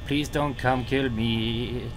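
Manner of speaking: ordinary delivery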